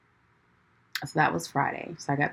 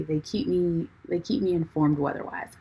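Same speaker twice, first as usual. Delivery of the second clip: ordinary talk, nothing marked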